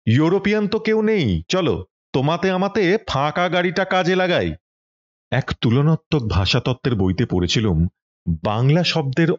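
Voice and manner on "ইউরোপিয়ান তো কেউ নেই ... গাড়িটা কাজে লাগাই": higher, first-syllable-stressed sahib Bengali; mock sahib accent, words punched on the first syllable; gank-gank English style. A narrator's reading. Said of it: "গাঁক গাঁক করে বললুম".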